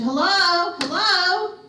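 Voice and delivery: high, sing-song call into the dark. Acted. Driver calling after the missing girl, high sing-song call.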